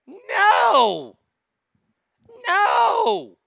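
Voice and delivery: high, loud, drawn-out "no"; outraged shout. Real, not acted. Host answering the advocate's plea, outraged shout.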